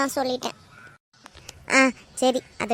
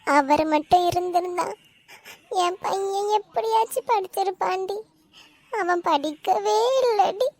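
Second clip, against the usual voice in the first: tearful lament. Acted. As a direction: grieve for your son through tears. Weeping mother laments her son's lost schooling.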